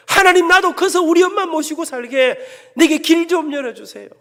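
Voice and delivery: loud, high plea for a way; pleading prayer. Retold or imitated. Son praying for his mother at dawn, loud pleading. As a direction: implore God for your mother's sake.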